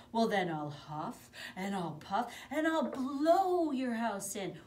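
loud, slow menacing wolf; threatening wolf. Acted. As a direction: threaten to blow the house down.

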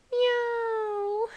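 high, drawn-out cartoon meow; bad cat impersonation. Player mimicking the game's cat sound, high cat meow. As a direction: mew like a little cat. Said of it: "if I make a bad cat, cat impersonation".

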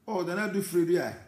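pleasantly surprised. Real, not acted. Recognizes a commenter by name.

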